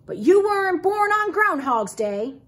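high, insistent character grumble; grumbling objection. A narrator's reading. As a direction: object forcefully as a grumbling old groundhog. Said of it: "grumbled Uncle Phil".

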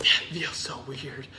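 echoed breathy whisper. Real, not acted. Copying a friend's whisper, whispering too. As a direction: whisper it back.

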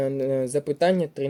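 ordinary delivery

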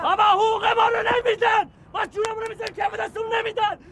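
desperate shout. Acted. Shouting he'll jump too.

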